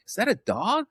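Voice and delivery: high, puzzled voice hearing a noise in the bushes; startled question. Retold or imitated. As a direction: ask it high and baffled, as if something rustled. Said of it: "I'm like".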